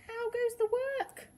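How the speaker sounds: high, loud farmer's call. A narrator's reading. Farmer calling to the duck, high loud call.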